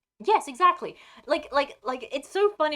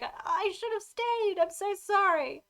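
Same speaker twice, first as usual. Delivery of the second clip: much higher and slow, a hammed-up lament; mock mournful Calenglad; 11 semitones higher, slower than usual. Retelling Calenglad missing his lake wife, in a mock lament. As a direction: wail it like a lovesick elf, overplayed. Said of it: "and he's like".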